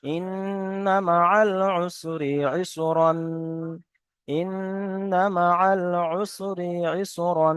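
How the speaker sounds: slow drawn-out tajweed recitation; Quranic recitation. Shown as a demonstration. Teacher to students, showing the wrong ending, slow recitation. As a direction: recite slowly, ending on the nasal ইসরান. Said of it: "এখানে কিন্তু ইসরন আছে".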